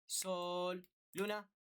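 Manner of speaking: long held "sol", then "luna" rattled off too quickly; wrongly timed rhythm. Shown as a demonstration. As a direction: stretch "sol", rush "luna," off the beat. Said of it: "No es correcto si tú haces".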